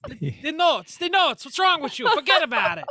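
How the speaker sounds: bad Italian-American accent, building into a shout; mock Italian accent. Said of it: "that was American".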